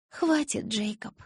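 weary reproof. A narrator's reading. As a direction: rein him in faintly but firmly. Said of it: "одёрнула меня Белла". (одёргивая, высоким слабым голосом)

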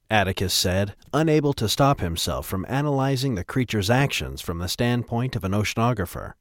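ordinary delivery

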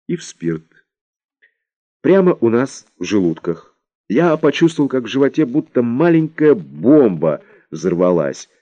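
ordinary delivery, nothing marked

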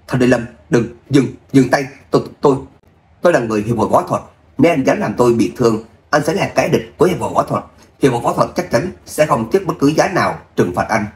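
ordinary delivery, nothing marked

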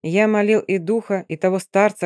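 ordinary delivery, nothing marked

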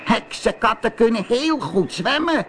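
high-pitched witch call; calling sea witch. Acted. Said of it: "riep de zeeheks". (roepende zeeheks, hoge stem)

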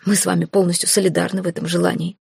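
plain reading voice

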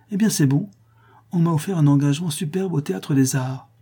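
high-pitched wounded pride; piquée. A narrator's reading. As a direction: throw it back, stung and defiant. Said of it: "dit-elle, piquée". (piquée, d'une voix plus aiguë)

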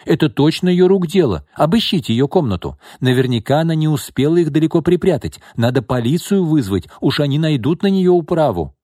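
high, loud, eager denunciation; excited, pressing accusation; gloating. A narrator's reading. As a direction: urge them on with malicious glee. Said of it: "злорадно воскликнула Лина".

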